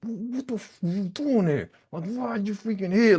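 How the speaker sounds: a hint of an angry high outburst; mild mock cursing. Imitating him cursing out Paris at the door. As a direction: curse her out, lightly hammed. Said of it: "he like".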